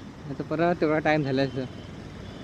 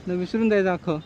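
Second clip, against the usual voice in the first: usual speaking voice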